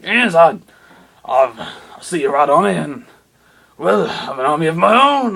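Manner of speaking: smug villain gloating about his own army. Acted. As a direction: boast like a cartoon baddie. Harold boasts of his army.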